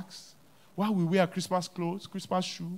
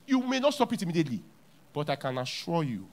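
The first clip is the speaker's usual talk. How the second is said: ordinary talk, nothing marked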